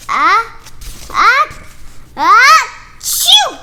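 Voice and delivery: rising ah ah ah into a loud achoo; building sneeze. Acted. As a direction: wind up and explode into a sneeze.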